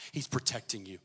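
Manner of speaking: normal talking voice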